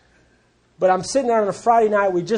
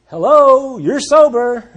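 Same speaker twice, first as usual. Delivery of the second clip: loud, higher, mock wake-up call; exasperated obviousness; 5 semitones higher, 8 dB louder, slower than usual. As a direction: point out what he's plainly missed. Wife points out the obvious to husband.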